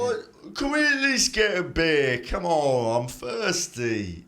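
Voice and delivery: high, loud, then slow whine; dumb giant head. Acted. GM voices the dumb head begging for beer. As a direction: whine it like a needy oaf.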